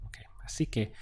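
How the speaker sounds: a quick murmured note to self; hushed, almost to herself; whispered aside. Real, not acted. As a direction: whisper it under your breath. Teacher aside while writing.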